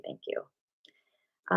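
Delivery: a breathy, unvoiced thank you; whispered thanks. Real, not acted. Grateful host whispering thanks to a super chat donor, hushed. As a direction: say it again as a soft whisper.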